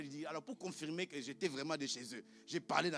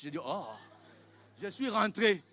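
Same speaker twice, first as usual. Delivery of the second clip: usual speaking voice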